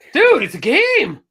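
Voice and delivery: high, loud, drawn-out objection; exasperated gamer's holler; exasperated protest. Retold or imitated. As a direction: shout it in disbelief.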